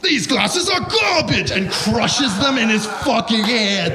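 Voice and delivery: high, loud, drawn-out furious yell; angry teacher outburst. Retold or imitated. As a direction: mimic an angry teacher blowing up, stretching the words. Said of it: "crazy angry fucking guy".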